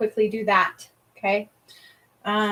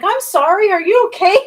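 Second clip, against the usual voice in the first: worried friend; 10 semitones higher, 10 dB louder than usual. Retold or imitated. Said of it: "They're like".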